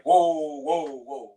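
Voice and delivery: loud startled "Whoa". Retold or imitated. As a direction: jump back with a loud whoa. Startled by rude reply.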